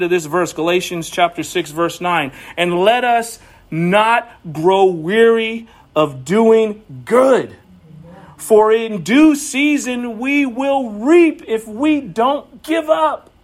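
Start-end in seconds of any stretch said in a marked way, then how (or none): 2.6-13.3 scripture reading. Acted. Reads verse in sermon.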